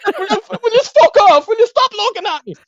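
very high, strained mock squeal; retold exasperated plea. Boy begging watching family to go, high exasperated squeal.

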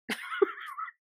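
a very high squeaky giggle; squeaky laugh. Real, not acted. Giggling after apologizing to fans, squeaky laugh.

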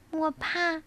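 high, small scared cub voice; little bear cub voice. Acted. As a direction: sound like a frightened little cub. (小熊胆怯的童声)

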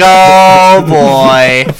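loud, drawn-out mock dread; big storytelling "uh-oh" voice; retold dismay. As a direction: stretch it out in comic alarm. Retold dread of being pulled over.

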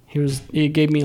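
ordinary talk, nothing marked

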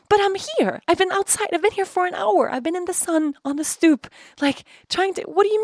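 high, insistent retold plea; upset pleading. Exhausted daughter telling mom she waited outside, pleading.

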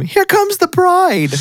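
high, slow mock scream of panic. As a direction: shriek it like a fleeing bystander. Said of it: "hear one person scream".

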